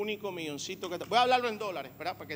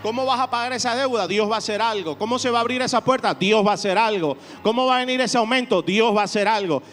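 loud, driving sermon questions and answers; rally the church with each answer; full-force preaching; 10 dB louder than usual. Acted. Pastor stirring his congregation, loud and driving.

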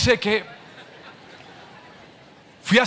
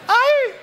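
sharp high mock yelp of pain, louder; 4 dB louder than usual. Preacher acting burned by frying oil, high pained yelp. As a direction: cry out as if burned by hot oil. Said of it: "¿qué dices?".

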